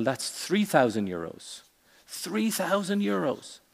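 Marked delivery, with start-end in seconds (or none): 2.1-3.6 high-pitched disbelief at the price; acted astonishment, pitched well up; incredulous echo. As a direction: repeat it back like a shocked customer. Customer echoes price in joke.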